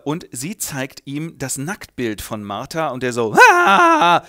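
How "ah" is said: mock surprise. Grandmother shows the nude picture.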